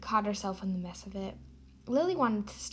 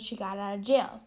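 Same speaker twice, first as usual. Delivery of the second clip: normal talking voice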